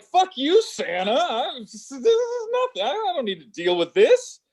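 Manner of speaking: fake-angry high yelp at Santa; half-hearted tantrum, pitch jumping up; mock outburst. Exasperated husband mock-yelling at Santa. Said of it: "sometimes it's also like".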